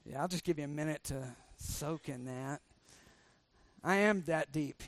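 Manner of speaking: normal talking voice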